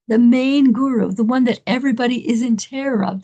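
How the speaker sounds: normal talking voice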